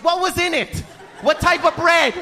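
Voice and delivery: high, pressing interrogation; anxious mother. Acted. Mom grilling son about sandwich, anxious nagging. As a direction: demand details like a fretting mom. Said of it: "She has anxiety".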